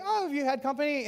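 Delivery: high-pitched curious visitor; friend's casual question. Acted. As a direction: voice a guest's innocent question.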